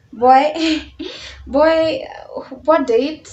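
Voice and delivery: amused, indignant disbelief; incredulous. Retold or imitated. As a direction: scoff loudly at the very idea. Acted shock at being called a date.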